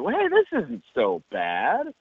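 drawn-out, high, hopeful approval; retold pleased surprise. Audience hearing the soundtrack begin, pleasantly surprised. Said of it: "we were excited for the soundtrack".

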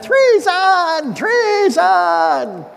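high, loud shrieking villainess; evil grandma. Acted. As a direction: cry treason like a wicked old queen. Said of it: "This sounded pretty good, like an evil grandma".

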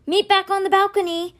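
high, sustained call; high-pitched narrated shout. A narrator's reading. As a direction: call it out to someone running off. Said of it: "he shouted".

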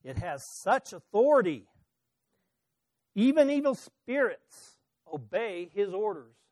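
loud, emphatic read-aloud amazement; excited crowd reporting a wonder. A narrator's reading. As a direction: exclaim it, stressing the key words loudly. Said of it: "they asked excitedly".

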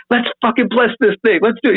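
keyed-up hype. Retold or imitated. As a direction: pump both of you up for it. Hyping the blessing.

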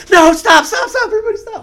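very high, frantic yell; imitated panicked shouting. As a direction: scream to halt the chaos. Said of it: "it was fucking chaos".

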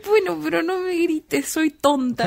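pleading whiny plea. Retold or imitated. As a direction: beg not to be yelled at. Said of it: "y yo como". (súplica quejumbrosa, más aguda)

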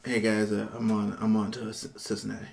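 low, quiet mumbled coach goodbye; lightly voiced gruff coach, low and soft; Bill Belichick impression. Coach slipping out on his team, a low mumbled hint of Belichick. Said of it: "this is my Bill Belichick impression".

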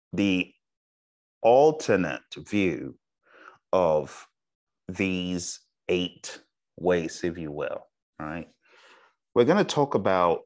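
slow, spaced phrases with long pauses; measured teacher's pace, pausing between word groups; deliberately slow. Real, not acted. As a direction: let each phrase sink in before the next. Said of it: "I am deliberately talking very slow".

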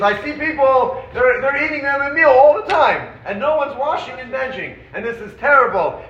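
hammed-up rabbinic outrage; playful indignant rant about people not washing; mock outrage. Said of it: "is yelling and screaming".

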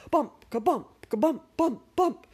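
loud, rising bump chant; bouncy bumps. Acted. The toboggan bouncing downhill, a loud rising bump chant.

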